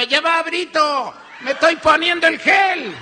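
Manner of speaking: very loud, high holler back; hammed-up wife's full-voice yell; tía Mecha yelling. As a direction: shout back from the bathroom, exasperatedly loud. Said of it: "del baño le gritaba".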